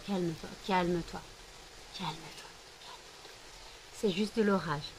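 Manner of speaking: calm the dog down gently; soothing pet. Real, not acted. Calming scared dog.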